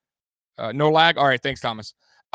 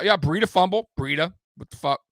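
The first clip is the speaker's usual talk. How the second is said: ordinary talk, nothing marked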